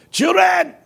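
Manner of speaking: very high, loud call; pastor's voice. Retold or imitated. Pastor addressing the students, high loud preaching call. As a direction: imitate an old-style preacher hailing the young. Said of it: "he talks like that".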